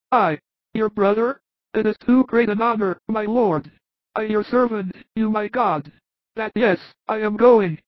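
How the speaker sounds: high, humbled apostle voice; moved Thomas. Acted. Thomas deeply moved by the master's blessing, high voice. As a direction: voice Thomas high, overcome with humility. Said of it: "and whispers".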